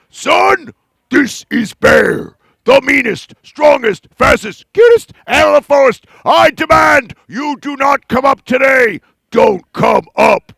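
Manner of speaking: loud, slow bear hiding his nerves; blustering bear with a hint of nerves; nervous mean bear. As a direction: put on a mean face over the nerves. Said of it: "Bear got very nervous".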